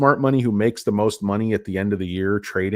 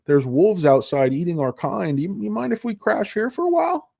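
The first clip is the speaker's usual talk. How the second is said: lightly voiced sweet-talking squatter; a touch of a sly houseguest begging to move in; mock-polite freeloader asking to crash; mock CATL pleading; 8 semitones higher than usual. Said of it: "They're like".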